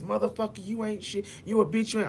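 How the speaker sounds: high-pitched retold insults; mock screaming and cussing. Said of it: "somebody screaming and cussing at you so much".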